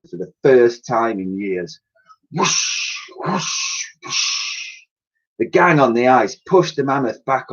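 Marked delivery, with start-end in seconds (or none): 2.3-4.8 breathy wind whoosh; whispered whooshing. Acted. Sails filling with wind, breathy whoosh.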